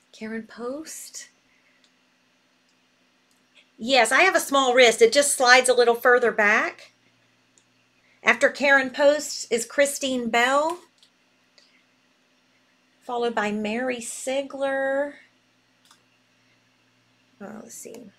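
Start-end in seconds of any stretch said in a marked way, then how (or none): 0.1-1.3 slow whisper of the name while typing; whispered to herself. Real, not acted. Host typing the order list, whispering the name to herself. 17.4-18.1 soft whisper to herself; whispered aside. Real, not acted. Host checking her list, whispering to herself.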